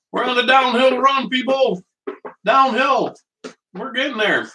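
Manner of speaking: high, upbeat announcing; lifted happy announcement; excited. Real, not acted. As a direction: cheer the viewers on. Cheering progress to viewers.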